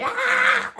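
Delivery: very high mock shriek; retold loud scream. Showing how she screamed when she fell, shrieking. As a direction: re-enact a shriek on falling. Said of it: "I screamed when I fell, loud".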